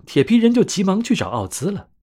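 ordinary delivery, nothing marked

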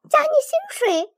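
猛地拔高嗓门，满怀期待地问; 眼睛一亮，兴奋得声音往上冲; 惊喜追问. Acted. 妻子听说老板召见，眼睛一亮. (妻子以为丈夫加薪，兴奋地高声追问)

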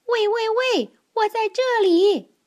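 high, loud teasing call. Acted. Webb teases the searching elephants, high loud call. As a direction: sing out from a hiding place. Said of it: "他一会儿叫道".

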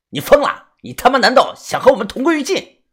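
high, alarmed outburst; angry shock. Acted. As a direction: yell in panicked fury. Thugs panic at police car. (惊怒大喊)

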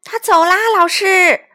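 high, loud report to teacher; children calling out. A narrator's reading. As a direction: call out urgently to the teacher. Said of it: "孩子们叫道".

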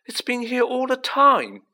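high, loud, gleeful exclamation; delighted. A narrator's reading. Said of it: "said Ron delightedly".

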